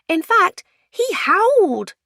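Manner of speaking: put-on very high, loud child's voice; piping, drawn-out child; blurting child. A narrator's reading. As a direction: proclaim it slowly like a little kid. Child chimes in.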